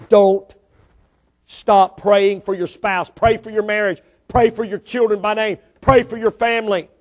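forceful preaching in punched phrases. Real, not acted. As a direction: drive each command home to the congregation.

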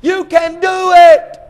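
high, loud holler of encouragement; yelling trainer. Acted. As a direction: yell the fighter up off the canvas. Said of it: "the guy's yelling".

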